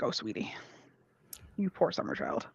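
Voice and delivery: hushed, pitying sweetness; gently patronize a poor naive child; softly condescending. Acted. Character pityingly condescends to a newcomer.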